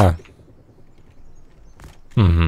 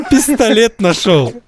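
laughing; 7 semitones higher, slower than usual. Real, not acted. Смеётся, найдя пистолет.

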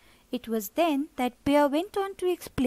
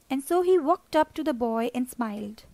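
ordinary delivery

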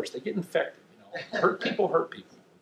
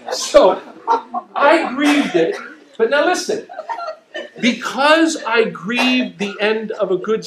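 higher, louder, laughing words; laughing still; 7 semitones higher, 7 dB louder than usual. Real, not acted. Preacher recovering from laughter, higher and louder. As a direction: talk through the laughter.

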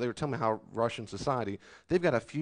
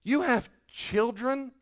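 much higher, slow incredulous question; incredulous rebuke; 10 semitones higher, much slower than usual. Acted. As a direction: stretch it out in mock disbelief. Preacher rebukes the complainers.

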